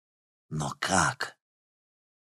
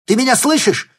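loud, high anxious shout; 11 dB louder than usual. Acted. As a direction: shout into the dead line. Call cut off.

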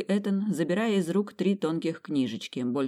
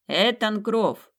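slow, louder formal announcement of a name; butler announcing; 5 semitones higher, 5 dB louder, much slower than usual. Acted. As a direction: proclaim the guest to the whole hall. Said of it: "а потом огласил".

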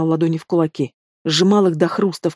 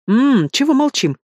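merry high taunt; bright cheerful mockery; 8 semitones higher than usual. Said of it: "спросил весело".

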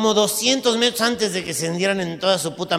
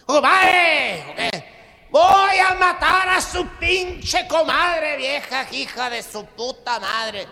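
high, loud, slow drawn-out rant; furious compadre; 10 semitones higher, 4 dB louder, much slower than usual. Acted. Norteño ranting to his compadre about killing his wife, loud and slow. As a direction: rage at the wife, stretch every word.

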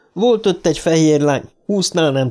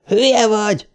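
high-pitched indignant retort; shouting back; 8 semitones higher than usual. A narrator's reading. Trev answers Dick over the firestorm, shouting back. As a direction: hurl it back, moderate volume. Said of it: "ordított vissza Trev azon a mély bika hangján".

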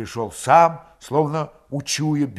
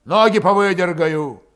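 loud, raised, hostile threat; menacing promise; 5 semitones higher, 8 dB louder, slower than usual. A narrator's reading. Grigory shoving Valet out the door, menacing. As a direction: send him off with menace. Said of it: "недобрым голосом пообещал".

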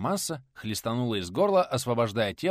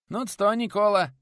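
high, loud hearty call; hearty peasant greeting; 11 semitones higher, 7 dB louder than usual. Acted. Nikodim hailing his friend at the table, high and loud. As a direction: boom it out like a jolly craftsman.

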